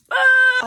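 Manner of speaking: loud, high shriek; fangirl scream. Real, not acted. Reader swooning over the hero's words, fangirl scream. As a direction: let out a piercing swooning scream. Reacting to a romantic line.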